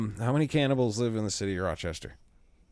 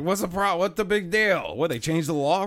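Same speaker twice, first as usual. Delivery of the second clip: put-on old man voice; 7 semitones higher, 4 dB louder than usual. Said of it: "He's like".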